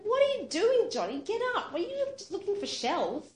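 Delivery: high, loud worried call to her sister; alarmed calling out. Retold or imitated. Kathy calls to Johnny lying in the water, high alarmed call. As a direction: call out sharply, unsure if she's playing.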